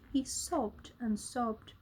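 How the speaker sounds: ordinary delivery, nothing marked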